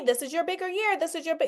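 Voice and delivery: a hint of a calm divine reassurance; lightly voiced God. God tells her this is her bigger year, lightly voiced. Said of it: "God is telling me".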